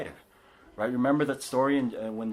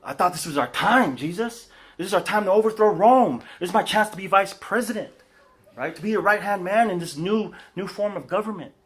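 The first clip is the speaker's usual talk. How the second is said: higher, louder, eager disciple; hammed-up Peter pleading with Jesus; 8 semitones higher, 5 dB louder than usual. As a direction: pitch it up like an excited follower with big plans. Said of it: "Peter probably reacted in this way".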